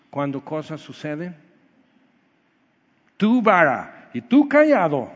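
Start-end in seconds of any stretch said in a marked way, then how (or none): 3.2-5.1 mock proclamation. Mocking the misreading again.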